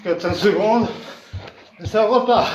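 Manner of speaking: ordinary talk, nothing marked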